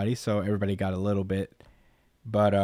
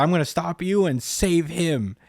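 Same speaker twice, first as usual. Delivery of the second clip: mock yelling; 6 semitones higher than usual. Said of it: "he just yells at him for a while".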